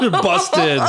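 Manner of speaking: high laughing teasing; laughing tease. Real, not acted. Co-host teasing her caught friend, laughing.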